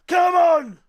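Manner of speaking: usual speaking voice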